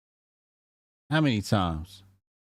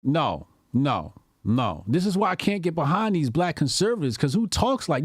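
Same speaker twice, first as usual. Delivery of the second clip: ordinary talk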